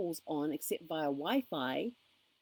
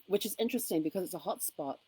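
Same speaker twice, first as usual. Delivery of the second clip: ordinary talk, nothing marked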